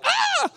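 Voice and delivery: very high-pitched comic shriek; mock scream. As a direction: let out a hammed-up scream of fright. Jehoshaphat flees pursuing army.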